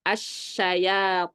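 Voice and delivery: asyayatinu slow, thick emphatic ta; itbaq demo. Shown as a demonstration. Ustadz demonstrating itbaq letters to students, slow heavy recitation. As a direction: stretch asyayatinu out heavily. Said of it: "kalau dia memiliki sifat itbaq".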